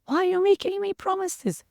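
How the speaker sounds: put-on high, pleading girlish voice; a teenage girl pushing back in dismay; young girl protesting. College girl protests to her dying sister, high and pleading. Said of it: "And this girl says".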